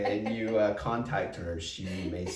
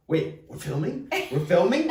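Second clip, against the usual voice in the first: rising, higher disbelief, growing louder; startled question; 8 semitones higher, 5 dB louder than usual. Retold or imitated. Speaker learning the camera is on, retold startled question. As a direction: imitate yourself caught off guard.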